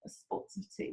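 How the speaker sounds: put-on hushed British accent. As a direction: echo it softly in put-on British. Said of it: "I do a great British accent".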